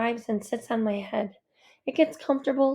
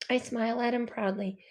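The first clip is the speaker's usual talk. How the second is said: ordinary delivery, nothing marked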